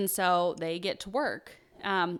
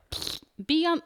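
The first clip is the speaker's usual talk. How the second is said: mock radio noise; 10 semitones higher, 4 dB louder than usual. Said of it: "That wasn't the noise I was trying".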